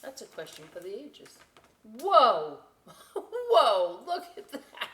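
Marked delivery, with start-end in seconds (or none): 2.0-4.9 loud surprised shout with a laugh; amazed laughing whoa. Real, not acted. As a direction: exclaim at the picture, laughing. Reacting to storm picture.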